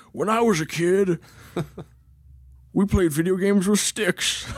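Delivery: a hint of a grumbling grandpa; old man voice. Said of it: "start using your old man voice".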